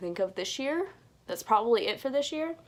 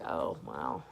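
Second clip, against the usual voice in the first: much lower, quiet, flat-toned oh wow; deadpan low voice; 10 dB quieter than usual. Retold or imitated. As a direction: drop into a low, quiet, unimpressed voice. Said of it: "I'm just like".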